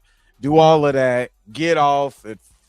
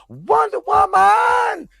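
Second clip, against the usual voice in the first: much higher, louder, stretched slow shout; failed attempt at a high Wonder Woman yell; Wonder Woman call; 14 semitones higher, 4 dB louder, slower than usual. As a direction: belt the name like a superhero battle cry, straining for the high note. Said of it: "Still can't do it. Voice ain't there no more".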